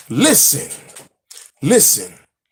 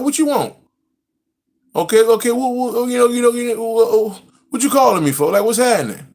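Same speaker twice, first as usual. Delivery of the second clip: higher, pressing annoyed retort; impatient retort; 5 semitones higher than usual. Retold or imitated. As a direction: push back, mock-exasperated. Retold snapping at caller.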